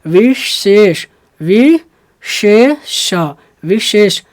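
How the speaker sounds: विशेष drawn out very slowly; slow teaching विशेष with long hissed sibilants; syllable-by-syllable demo. Shown as a demonstration. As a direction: pull विशेष apart into वि, शे, ष. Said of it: "जैसे".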